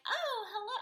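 high-pitched, hammed-up delighted hello; mock-giddy squeal of a greeting. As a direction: imitate someone thrilled to see their date. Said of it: "she's so excited to see Jed".

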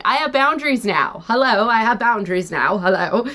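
high, hammed-up proclamation, rising to very high at the end; mock performative announcement. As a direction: parade your new boundaries like a sign-waver. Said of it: "this really big performative thing".

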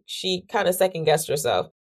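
usual speaking voice

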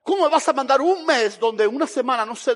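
exploding in protest. Retold or imitated. Preacher objecting to God about a month-long campaign, exploding in protest. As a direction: blow up in disbelief, loudly. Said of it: "ahí exploté yo y dije".

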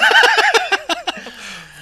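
high burst of laughter; shrieking laugh. Real, not acted. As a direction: crack up laughing. Big laugh.

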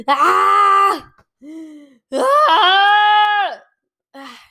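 full-throated release screams; real shout. Real, not acted. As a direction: let it all out, yelling. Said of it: "Estava a precisar de berrar".

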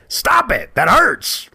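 high-pitched mock yelp of pain; pained protest. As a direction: protest sharply as if just hit. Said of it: "but the tone and the scowl say".

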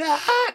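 mock baby scream, high-pitched; retold baby scream. Mock baby scream while telling how she yelled. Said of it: "empezó a gritar".

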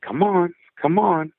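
high, eager come-on; urgent coaxing. Retold or imitated. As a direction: coax him along. Friend urging boy into woods.

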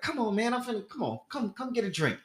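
lightly voiced pushy friend urging him inside; coaxing friend.

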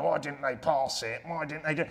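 a fan griping quietly to himself; mock mumble. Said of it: "having a mumble to themselves going".

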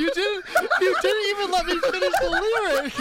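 high laughing protest. Real, not acted. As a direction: laugh through the complaint. Laughing complaint after interruption.

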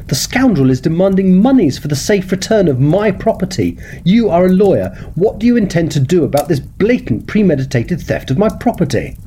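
bristling with indignation at the theft; indignant outrage. Acted. Client demanding the lawyer act on the theft, indignant.

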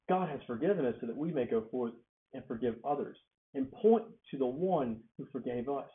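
normal talking voice

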